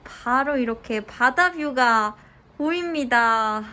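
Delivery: usual speaking voice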